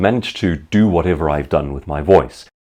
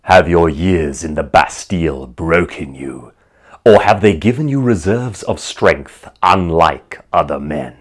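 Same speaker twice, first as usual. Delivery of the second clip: Jeremy Irons impression; 3 semitones lower than usual. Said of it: "where he asks him".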